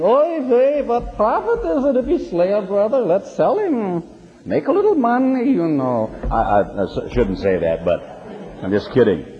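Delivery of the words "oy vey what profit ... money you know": high, exaggerated Yiddish accent. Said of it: "You can almost hear him saying".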